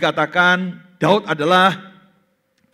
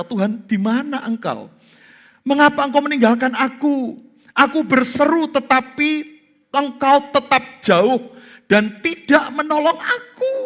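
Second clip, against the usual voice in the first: anguished cry; 8 semitones higher, 3 dB louder than usual. Pretend. David's desperate cry voiced. (seruan putus asa, lebih tinggi dan lambat)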